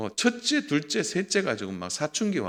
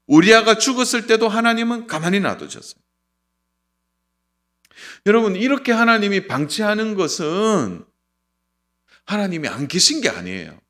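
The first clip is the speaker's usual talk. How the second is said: loud, raised preacher's voice; forceful preaching; 7 semitones higher, 6 dB louder than usual. Real, not acted. Preacher warning congregation that God may wait, forcefully. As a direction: drive each example home to the congregation.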